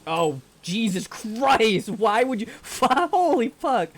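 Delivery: real jump-scare shriek, high and loud; terrified, swearing outburst; startled scream. Real, not acted. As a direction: cry out in sudden fright, voice leaping up. Sudden scare in horror game.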